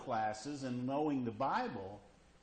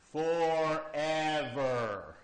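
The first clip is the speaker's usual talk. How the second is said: drawn out; 3 dB louder, much slower than usual. Acted. Answering how long eternity lasts.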